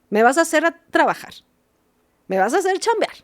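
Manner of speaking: high, mocking complaint; mock inconvenienced psychologist. Psychologist resenting a child's truth, high mock complaint. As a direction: parody a put-upon worker, pitch climbing. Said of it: "a mí me incomodas".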